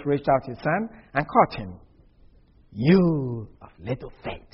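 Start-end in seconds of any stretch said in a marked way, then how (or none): 2.7-4.5 the Lord, rebuking. Retold or imitated. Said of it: "he said".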